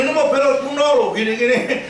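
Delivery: mock the friends ribbing his old car; teasing mockery. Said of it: "Kadang-kadang diledekin".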